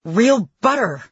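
forced out loud through gritted teeth. A narrator's reading. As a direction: push "Real butter" out through clenched teeth, loud and high. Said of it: "through gritted teeth".

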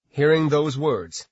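usual speaking voice

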